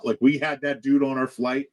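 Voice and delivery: ordinary talk, nothing marked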